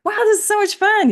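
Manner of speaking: a playful imitation of someone having a blast; mock delight. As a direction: gush like it's the best time ever. Imagined happy moment quoted.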